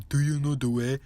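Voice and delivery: lightly imitated Ugandan accent meme voice; mock Ugandan accent. Commentator quoting an overused Knuckles meme, mock Ugandan accent. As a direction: put on a hint of a Ugandan accent. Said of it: "full-on manly Ugandan accents".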